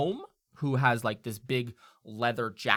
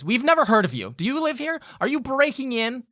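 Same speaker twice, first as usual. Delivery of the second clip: much higher, louder accusing fairy; suspicious fairy; 12 semitones higher, 7 dB louder, faster than usual. Acted. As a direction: fire off high suspicious questions. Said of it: "this fairy turns back to you and goes".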